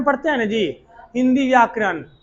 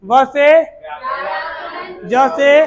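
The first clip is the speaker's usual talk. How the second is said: ब्याजो called out loud, syllable by syllable; loud drill call-out; 3 semitones higher, much slower than usual. Shown as a demonstration. Teacher points at each letter for students, loud call-out. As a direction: call each cue loudly so the class answers. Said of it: "चार बार बोलना है".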